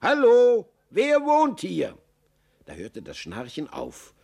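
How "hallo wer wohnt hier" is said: loud, high hallo into a burrow; high, ringing call to whoever is inside. A narrator's reading. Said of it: "und so rief er".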